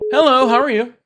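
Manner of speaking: high bright call-in greeting; upbeat high hello to a stranger on the line; chirpy host greeting. Acted. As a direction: greet the caller like a game show host. Greeting the next caller.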